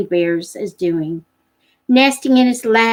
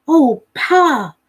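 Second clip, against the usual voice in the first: put-on higher, pleading boy voice; begging boy; 5 semitones higher, 4 dB louder than usual. Jody begs his father to catch a cub, pleading. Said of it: "Jody begged".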